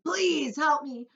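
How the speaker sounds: high, loud, slow desperate begging; bawling plea. A narrator's reading. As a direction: beg for help, howling. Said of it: "bawled the centipede".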